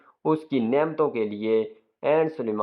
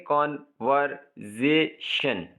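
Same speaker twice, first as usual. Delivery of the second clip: "Conversation" slowly in four separate beats; syllable by syllable; much slower than usual. Shown as a demonstration. Teacher dividing an example for learners, "conversation" slowly syllable by syllable. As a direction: pause after each syllable of "conversation": कॉन, वर, से, शन. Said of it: "syllables को separate कर रहा है".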